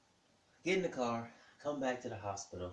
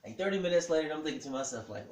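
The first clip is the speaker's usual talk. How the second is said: ordinary delivery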